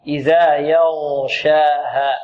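slow, drawn-out "يغشاها" with a clean light ya before heavy ghayn; correct ghayn articulation. Shown as a demonstration. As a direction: recite "يغشاها" slowly, ya kept thin and ghayn held. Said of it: "لا نقول يغشاها". ("يغشاها" بتأنٍّ مع ترقيق الياء وتفخيم الغين)

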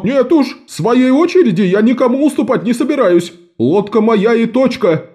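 high, stubborn merchant voice, slowing at the end. A narrator's reading. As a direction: dig in, refuse flatly. Merchant refuses to yield.

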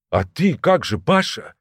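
ordinary delivery, nothing marked

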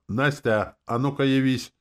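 loud, high imperious summons; commanding. A narrator's reading. Hero ordering the demon to appear, commanding. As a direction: summon with full authority. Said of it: "сказал властно".

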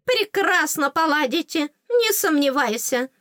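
high, cheeky fairy voice; teasing fairy. Acted. Fairy assures heroine about the prince, high teasing. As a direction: tease in a little high-pitched voice.

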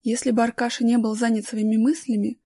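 ordinary delivery, nothing marked

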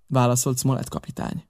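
usual speaking voice

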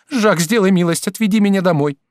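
high, loud woman's plea; urgent pleading. A narrator's reading. Woman begs lover to take her home, urgent pleading. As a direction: beg him insistently.

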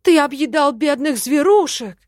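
loud, high mock outrage; hammed-up accusation from a scandalised ferret; theatrical outcry. A narrator's reading. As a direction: exclaim it with feigned horror. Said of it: "воскликнул Хорёк, наигранно схватившись за сердце".

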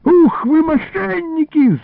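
high, drawn-out alarm cry; yelling. A narrator's reading. Illarion to thieves from a tree, yelling. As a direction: holler high, stretching the vowels. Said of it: "заорал вдруг Илларион".

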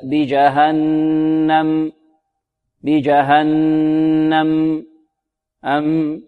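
"بجهنم" very slow, n hummed long and final m held; measured final mim. Shown as a demonstration. Teacher correcting the stop on mim, measured final hum. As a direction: let the closing m of "بجهنم" ring for a medium count. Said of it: "نعطيها قدرها من التوسط".

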